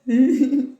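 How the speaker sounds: loud burst of high laughter; a big high laugh at her own joke. Real, not acted. Joking to viewers about ayahuasca, big high laugh.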